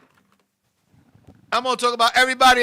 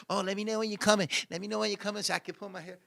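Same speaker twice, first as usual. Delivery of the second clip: put-on quick, quieter hurried girl voice; mock girl; 6 semitones lower, 16 dB quieter, much faster than usual. Imitating girls asking to be told when he's coming, quick and quiet. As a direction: rattle it off like a busy girl. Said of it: "they be like".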